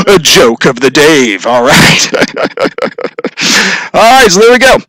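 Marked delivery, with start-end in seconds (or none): none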